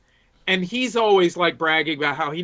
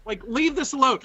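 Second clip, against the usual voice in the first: alarmed protest; 6 semitones higher than usual. Retold or imitated. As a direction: stop him in disbelief, pitched up high. Telling buddy not to go back.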